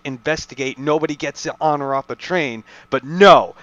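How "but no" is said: loud, exasperated "But no"; exasperated shout. Real, not acted. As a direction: blurt it out in exasperation. Exasperated at plot holes.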